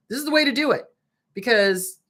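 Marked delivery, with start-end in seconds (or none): none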